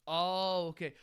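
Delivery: ordinary talk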